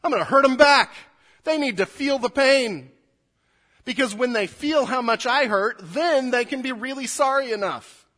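loud, high, bitter cry for revenge; vengeful anger. Retold or imitated. Pastor acts out a hurt person vowing revenge, loud and high. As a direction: spit out the wish to hurt them back. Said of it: "what do we want to do to the person".

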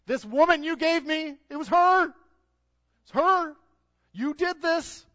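very high, loud whining blame; whiny Adam complaining. Acted. Adam to God, shrill whining blame. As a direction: whine and point the finger. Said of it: "He complains".

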